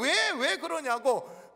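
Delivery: dragged-out why, pressing heaven for answers; slow, stretched, accusing question; mock anguish shaken at the sky; slow bitter demand. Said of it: "하늘 향해 막 삿대질하고".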